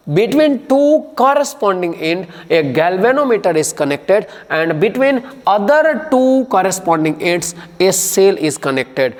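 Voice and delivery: slow, even dictating pace; slow dictation. Shown as a demonstration. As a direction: read it out slowly for notes. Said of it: "Once again".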